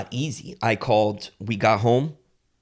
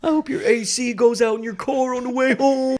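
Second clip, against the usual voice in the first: heckling the umpire; 12 semitones higher, 4 dB louder than usual. Retold or imitated. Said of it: "He's yelling these things at the ump".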